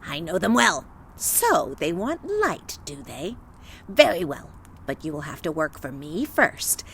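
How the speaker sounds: put-on low, menacing old witch. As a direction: growl it like a sly hag. Witch voiced in the story.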